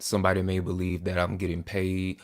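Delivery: ordinary talk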